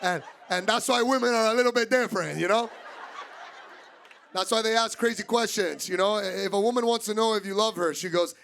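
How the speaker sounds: ordinary talk, nothing marked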